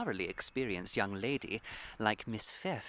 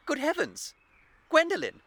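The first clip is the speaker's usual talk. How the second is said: high, loud startled cry; shocked exclamation; 9 dB louder than usual. Acted. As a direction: exclaim as if caught out. Caught out by his fiancée.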